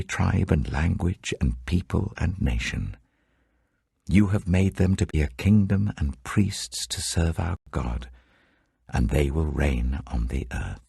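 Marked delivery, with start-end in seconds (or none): none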